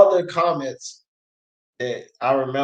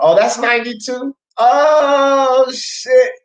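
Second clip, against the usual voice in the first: real surprise, higher voice rising into a loud drawn-out oh; surprised realization; 9 semitones higher, 4 dB louder, slower than usual. Real, not acted. Streamer recognizes commenter ninety-two, real surprise. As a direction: let it dawn on you, pitch jumping up.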